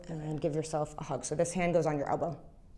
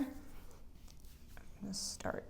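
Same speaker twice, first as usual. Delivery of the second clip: slow whisper under breath; 17 dB quieter, much slower than usual. Real, not acted. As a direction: whisper it slowly while working. Adjuster muttering to himself.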